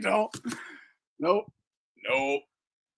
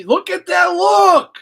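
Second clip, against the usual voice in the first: shouted, much higher and louder, slow; excited shout; 12 dB louder, slower than usual. Real, not acted. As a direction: burst out at the screen. Excited at video.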